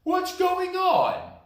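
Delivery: a bear just woken, calling out; hammed-up sleepy bear. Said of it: "he asked".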